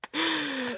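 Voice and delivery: high, embarrassed little laugh; nervous laugh. Real, not acted. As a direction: laugh off the teasing. Guest laughs after being teased.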